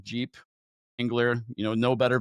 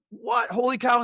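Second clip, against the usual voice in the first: high, astonished exclamation; amazed; 10 semitones higher than usual. Retold or imitated. Recognizing his own cousin in the car, astonished. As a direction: gasp it in disbelief.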